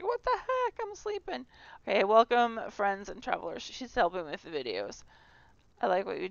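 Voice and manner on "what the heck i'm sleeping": much higher, squeaky woken-up complaint; high-pitched mock protest from a sleepy companion; Miss Rosebud voice. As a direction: squeak it like you've just been woken. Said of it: "And Miss Rosebud's like".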